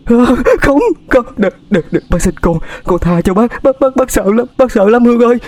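very high, terrified pleading; old woman begging the ghost in terror; panicked moaning. Acted. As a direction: cower and beg in panic. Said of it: "rên rỉ hoảng loạn".

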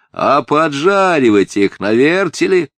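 high, loud relentless questioning; merciless. A narrator's reading. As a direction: press on without mercy. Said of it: "безжалостно продолжал мальчик".

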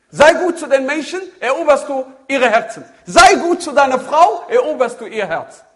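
forceful preaching. Acted. Preacher calls the brothers to kindness, loud and ringing. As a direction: proclaim it to the whole room.

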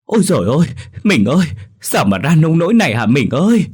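high, loud grief-stricken wail; old wife wailing over her injured husband; wailing cry. Acted. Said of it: "chạy theo la hét".

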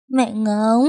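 put-on a sobbing child calls out; crying call for mom. A narrator's reading. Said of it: "Khóc đến khàn cổ họng". (bé khóc gọi mẹ, giọng cao nghẹn ngào)

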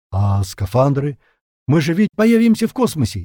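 uncertain. A narrator's reading. Zhenka fears appearing in space without suits, uncertain. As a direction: object anxiously, not convinced. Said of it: "неуверенно спросил Женька".

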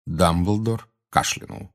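ordinary delivery, nothing marked

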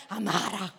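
thick Barese "amara" full of aspirated H sounds; heavy Bari accent. Shown as a demonstration. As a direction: say "amara" in broad Bari dialect, heavily aspirated. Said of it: "lo fai anche con tante H". (accento barese bello carico, con tante H)

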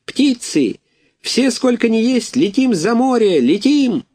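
rally all the birds to fly away; proud high battle cry; gander's rallying cry. A narrator's reading. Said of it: "крикнул".

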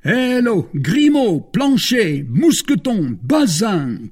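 loud, high hail to servants; calling out. A narrator's reading. Four young men calling their lackeys, loud high call. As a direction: call each name out, stepping down in pitch. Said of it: "cried the four young men, calling their lackeys".

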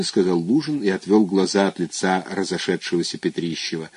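usual speaking voice

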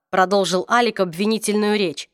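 usual speaking voice

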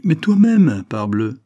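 high, loud accusing retort; heated retort. A narrator's reading.

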